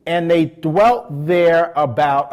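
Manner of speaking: slowed "dwelt there"; stress on dwelt. Shown as a demonstration. Preacher having the congregation underline "dwelt there", slowing. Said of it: "underline the next two words".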